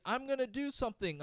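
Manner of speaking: lightly mock the boastful enemy; high mocking voice. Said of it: "The guy's like".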